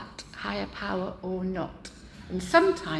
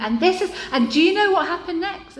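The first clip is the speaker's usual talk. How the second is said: much higher and louder, laughing; excited laughing build-up; 9 semitones higher, 11 dB louder than usual. Retold or imitated. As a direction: burst out eager for the punchline. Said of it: "and I go".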